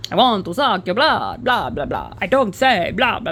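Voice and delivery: hammed-up vampire voice with blah blah; mock vampire accent from Dracula; Dracula impression. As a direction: do a cheesy Count voice. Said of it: "what's his name".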